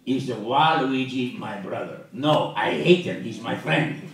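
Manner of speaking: loud, drawn-out cartoon villain; slow, booming scoundrel voice; Wario. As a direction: argue with yourself as a brash game baddie. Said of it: "You want to talk to Wario".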